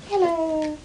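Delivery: put-on Gordon Ramsay doing an old lady; soft high old lady hello. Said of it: "That's my new voice".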